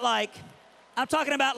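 ordinary talk

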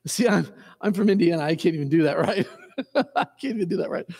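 real amused laughter between words; quick, self-mocking, breaking into laughs; laughing. Real, not acted. Preacher admitting a botched accent to the congregation, laughing.

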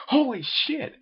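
a high excited exclamation; exclaimed. A narrator's reading. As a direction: blurt it out in shock. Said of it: "exclaimed Freddy".

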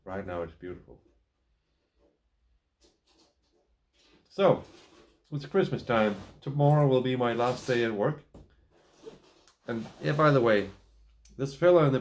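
talking around clenched pipe; pipe in mouth. Real, not acted. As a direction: lips held around pipe stem. Seen 2: Pipe clenched in teeth throughout speech; removed by 12.8 s.

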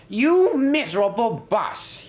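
high-pitched, indignant outburst; angry shout. A narrator's reading. Crashed inspector berates the bus, shouting. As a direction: lash out in high-pitched outrage. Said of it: "he shouted".

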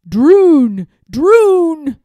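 loud, drawn-out cry of alarm; alarmed gasp. A narrator's reading. Lord Droon panicking as the king comes near, alarmed. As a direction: cry out in panic at being discovered. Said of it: "gasped Lord Droon".

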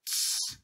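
soft hissed shush; quiet, voiceless "tsss". A narrator's reading. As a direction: hush someone secretively. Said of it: "приложил палец к губам".

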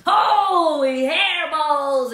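high, loud, stretched-out cry of alarm; shocked exclamation. Acted. Kitty boy seeing his huge head in the mirror, alarmed cry. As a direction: gasp it out at the mirror. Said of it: "exclaimed Skippy John Jones".

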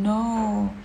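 ordinary talk

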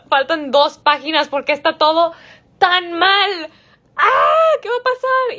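high, building to a squealed outcry; exasperated, then shriek in rising panic; panicked reader. Retold or imitated. Reader reacting to a book's cliffhanger, panicked and shrieking. Acts out panic at book ending.

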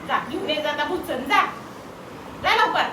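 loud high urging; loud sing-song urging. Real, not acted. Sends baby to fetch.

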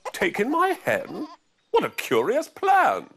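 put-on high, loud astonished old woman; old lady voice. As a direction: gasp it like a shocked old lady.

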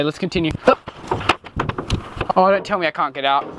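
ordinary talk, nothing marked

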